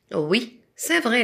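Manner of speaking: ordinary talk, nothing marked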